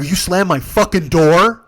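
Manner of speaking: slow, high, loud challenge; angry confrontation. Retold or imitated. Driver confronting passengers over slammed door, retold angry challenge. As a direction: stretch out the outraged question.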